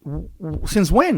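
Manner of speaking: a mock baffled wife tripping over her words; the wife can't believe football is still on, stammering high in surprise; stammering confusion. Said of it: "She was confused".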